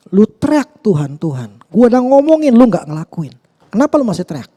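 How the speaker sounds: high, loud exasperated rebuke; everyday-language scolding. Retold or imitated. God rebuking those who cry to Him, exasperated. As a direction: scold them loudly as God, in street talk. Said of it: "secara bahasa sehari-hari kayak gitu".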